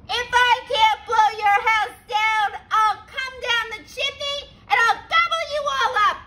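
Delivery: high, loud, then fast furious yell; shouting wolf. A narrator's reading. Wolf on the roof yelling at the pigs, loud. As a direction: roar down the chimney in anger. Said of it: "shouted down the chimney".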